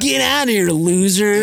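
high, jeering bully voice; eighties bully. Acted. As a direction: taunt like a movie bully. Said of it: "some guy that's an eighties bully".

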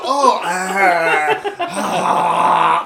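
loud hammed-up moans; mock moaning. Pretend lovers in traffic, loud hammed-up moans. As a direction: ham up lewd moaning. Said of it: "I'd be all".

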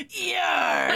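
a shouted pirate yar. Pretend. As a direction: bark it like a pirate.

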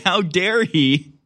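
slow, drawn-out mock indignation; mock outrage. Mocking outraged residents, drawn-out mock indignation. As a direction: savor each word like a scandalized socialite. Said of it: "Oh dear. Oh my".

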